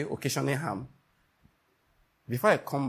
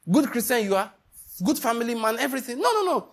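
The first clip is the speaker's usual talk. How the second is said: climbing higher into an emphatic no, no, no; rising mock praise; 7 semitones higher than usual. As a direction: build up the flattery then burst into denial. Building mock praise then denial.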